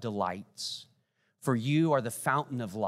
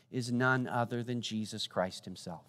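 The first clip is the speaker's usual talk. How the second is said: normal talking voice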